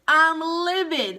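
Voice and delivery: hammed-up livid outburst. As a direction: punch it slowly with indignant force.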